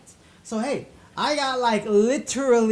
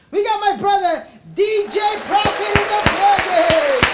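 hype introduction; 11 semitones higher, 9 dB louder than usual. Acted. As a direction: announce him like a headliner. Introducing the guest DJ.

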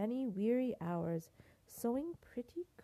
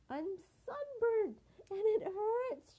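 wailing mermaid; 11 semitones higher, 4 dB louder than usual. Acted. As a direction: cry out like a sore little mermaid. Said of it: "she cried".

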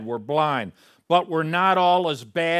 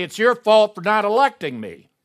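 higher, louder indignant complaint; mock accusation; 7 semitones higher, 9 dB louder than usual. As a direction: play the sinner blaming God. Sinner blaming God at judgment.

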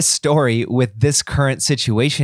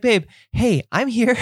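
soothing dad; 11 semitones higher than usual. Retold or imitated. Dad soothing daughter, retold.

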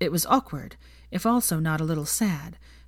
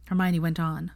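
ordinary delivery

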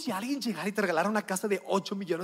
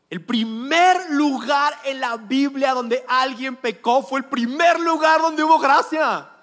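full-force preaching; 6 semitones higher, 8 dB louder than usual. Acted. Preacher booming to the congregation, loud and emphatic. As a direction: proclaim it to the whole room.

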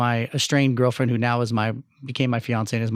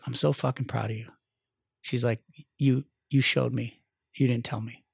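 tender whisper-soft praise; 2 semitones lower, 7 dB quieter than usual. Retold or imitated. Wife softly praising him in Italy.

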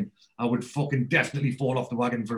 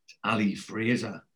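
low, slow and resigned; soft, reluctant confession after a sigh; sighing admission; 4 semitones lower, 5 dB quieter than usual. Retold or imitated. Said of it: "sighs and he goes".

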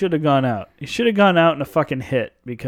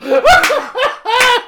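high burst of laughter; loud laugh; 5 dB louder than usual. Real, not acted. Cracking up at his co-host's quote, loud laughter. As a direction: howl with laughter. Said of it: "Dude, like you said".

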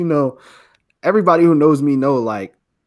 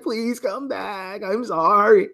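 imitated crying plea; 7 semitones higher, much slower than usual. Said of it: "ugly crying".

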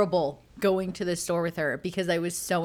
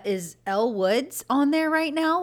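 Elle Woods voice; 7 semitones higher, 5 dB louder than usual. Retold or imitated. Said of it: "high-pitched, girly voice".

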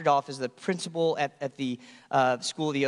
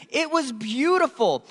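usual speaking voice

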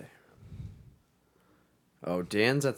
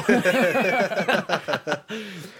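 high bursts of real laughter; laughing at himself; 9 semitones higher, 6 dB louder than usual. Real, not acted. Friend laughing at his own slip, high loud laughter.

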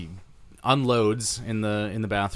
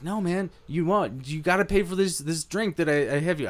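angry yelling; 7 semitones higher than usual. Retold or imitated. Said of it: "yelling at this man".

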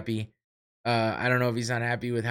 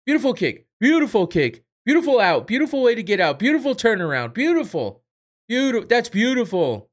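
high, loud mock praise; hammed-up beautiful chant; mocking Conor cornering; 10 semitones higher, 8 dB louder than usual. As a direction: gush it like a cornerman on repeat. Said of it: "Every fucking thing his fighter did".

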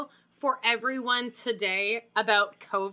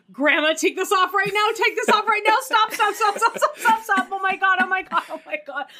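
frantic high shouting; panicked screaming at grandma to stop; screaming crying fan; 9 semitones higher, 8 dB louder than usual. Retold or imitated. Family yelling at grandma.

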